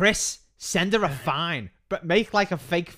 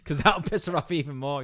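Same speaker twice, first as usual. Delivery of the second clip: ordinary delivery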